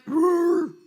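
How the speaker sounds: loud, high mock Wookiee roar; Chewbacca roar. Husband roaring at the Chewbacca actor, playful loud mock roar. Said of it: "My husband roared at the Chewbacca guy".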